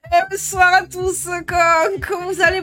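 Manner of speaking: put-on high, playful southern accent greeting; hammed-up Marseille twang. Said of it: "Elle passe du beauf à la pique-me".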